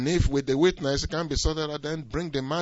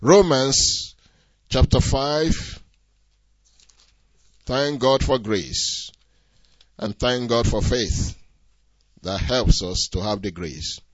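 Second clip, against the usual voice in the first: ordinary talk, nothing marked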